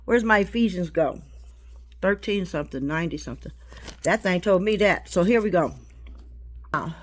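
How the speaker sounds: loud, driving sermon delivery; preaching at full force. Acted. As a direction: preach it out to the whole room.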